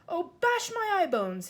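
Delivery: distressed cry. Acted. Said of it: "he cried".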